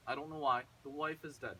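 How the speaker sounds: usual speaking voice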